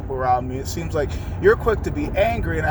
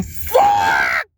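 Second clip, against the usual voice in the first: full-throated real scream of frustration; 6 dB louder than usual. Real, not acted. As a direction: let it rip, screamed out loud. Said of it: "but I gotta scream".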